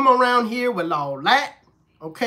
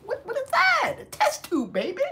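much higher, shrill disbelief; baffled; 4 dB quieter than usual. Real, not acted. Coworker baffled by "shut up a smidge", squealing disbelief.

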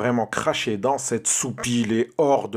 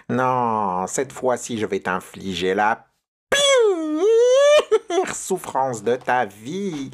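witch cry falling to a breathy whisper; 2 semitones higher, slower than usual. Acted. Witch announces her revenge.

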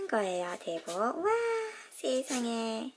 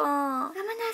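ordinary talk, nothing marked

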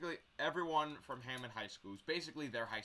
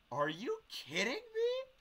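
high, loud, drawn-out disbelief; re-enacted outburst at the umpire; incredulous outrage; 5 dB louder, much slower than usual. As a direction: protest the call, stretching it out slowly. His outraged reaction to being ejected.

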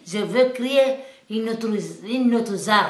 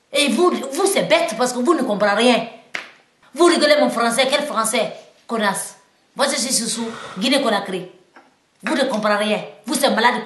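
colère contre les moqueurs; 5 semitones higher, 8 dB louder than usual. Real, not acted. S'en prend à ceux qui se moquent.

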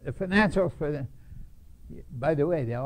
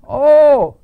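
big exclamation; 12 semitones higher, 18 dB louder than usual. Real, not acted. Exclaiming how mad he was.

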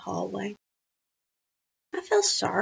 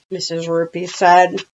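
plain reading voice

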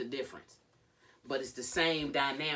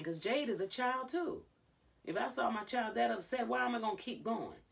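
normal talking voice

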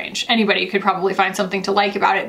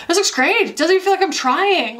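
higher, bright rising delight; 8 semitones higher than usual. Retold or imitated. Shopper pleased applying bronzer, delighted. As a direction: gush at the mirror. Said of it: "you're like".

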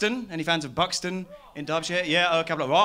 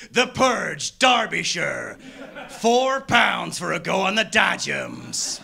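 building rant; 5 semitones higher, 5 dB louder than usual. Acted. Escalating rant about carnival.

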